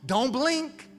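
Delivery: wise elder advice. Retold or imitated. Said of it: "you tell parents like me".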